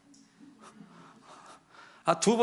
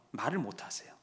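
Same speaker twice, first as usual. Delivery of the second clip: usual speaking voice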